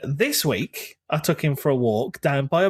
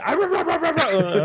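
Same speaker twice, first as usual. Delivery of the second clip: repeated higher barking oh sounds; imitating dog bark; 12 semitones higher than usual. Owner mimicking his big dog barking, mock barking. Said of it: "So he says".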